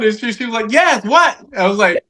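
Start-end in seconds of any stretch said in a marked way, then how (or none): 0.7-1.4 impatient mom. Retold or imitated.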